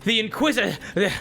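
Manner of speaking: building from calm report to a shouted order; soldiers turning hostile. Acted. Troops reporting to the party, rising to a furious kill order. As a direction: start matter-of-fact, end barking the kill order. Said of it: "they go, roleplay moment".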